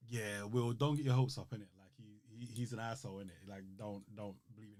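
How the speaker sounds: lightly voiced Uncle Phil, warning quietly; hushed warning. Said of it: "Uncle Phil's like".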